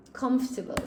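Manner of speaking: "Comfortable" in three syllables, first one stressed; pronunciation demo. Shown as a demonstration. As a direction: say "Comfortable" as CUMF-ta-ble. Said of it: "I almost say it like this".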